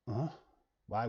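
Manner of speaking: normal talking voice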